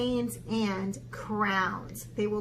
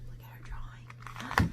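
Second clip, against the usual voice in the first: hushed suspense whisper; breathless storytime whisper; 8 dB quieter than usual. Acted. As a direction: draw the kids in, secretly. Suspense for the children.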